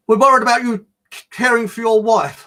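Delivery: high, loud officious mock voice; mock concern from a snotty official; snotty policewoman. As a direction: imitate a snooty policewoman. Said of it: "this snotty policewoman said".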